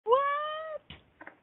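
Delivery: high, squealing "What? What?"; stunned disbelief. Retold or imitated. Retelling the ghost's reply, squealing in disbelief. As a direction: shriek it out in total disbelief. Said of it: "I'm like".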